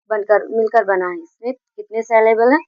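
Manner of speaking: ordinary talk, nothing marked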